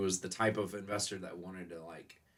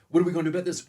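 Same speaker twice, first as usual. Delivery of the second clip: louder and higher, mock frantic; hammed-up frantic question; mock panic; 8 semitones higher, 8 dB louder, much faster than usual. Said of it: "every day was like".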